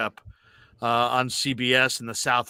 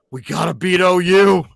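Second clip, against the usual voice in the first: obsessed fan; 5 semitones higher, 9 dB louder than usual. Retold or imitated. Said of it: "they're just walking around going".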